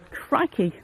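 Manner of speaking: ordinary talk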